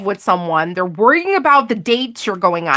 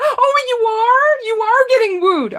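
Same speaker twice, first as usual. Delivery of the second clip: much higher, playful mock surprise; teasing; 11 semitones higher than usual. Real, not acted. Reader teasing the viewer about being wooed. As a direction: tease the listener, pitch way up.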